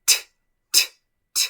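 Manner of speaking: "t" como golpe breve de aire; sonido de T. Shown as a demonstration. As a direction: repetir "t" aislada, sin vocal y con pausas. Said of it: "Mi lengua baja y bota aire". ("t" sin voz, la lengua suelta el aire)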